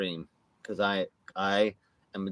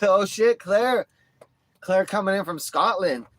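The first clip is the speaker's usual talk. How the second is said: excited announcer; 8 semitones higher, 5 dB louder than usual. Pretend. Hyped ring announcement.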